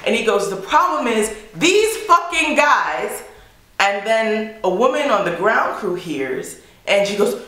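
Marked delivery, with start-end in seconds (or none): 0.5-3.2 high, slow mock rant; exasperated outburst. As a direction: imitate a fed-up coworker, drawn out and high. Fed-up coworker's outburst.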